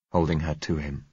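plain reading voice

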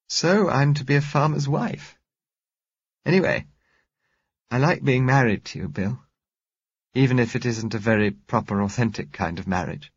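half-rueful, fond; Josella, rueful and fond. A narrator's reading. Josella teasing husband Bill about marriage, fond. Josella's lines voiced by narrator.

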